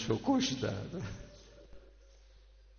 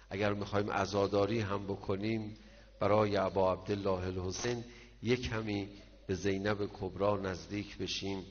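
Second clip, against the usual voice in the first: normal talking voice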